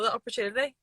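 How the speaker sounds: ordinary talk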